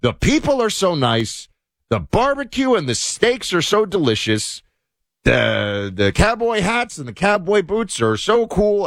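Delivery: put-on high, gushing delight, no accent; gushing tourist. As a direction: rave like a thrilled visitor. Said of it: "I won't do their accents".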